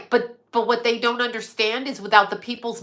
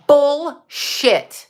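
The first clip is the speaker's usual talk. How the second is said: louder bullshit; 5 semitones higher, 6 dB louder than usual. Real, not acted. Repeats it louder, higher.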